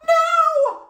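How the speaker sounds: high, loud desperate shout. A narrator's reading. Marshall shouting no to save his blanket, high and loud. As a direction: scream it in protest. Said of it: "Marshall shouted".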